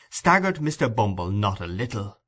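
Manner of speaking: usual speaking voice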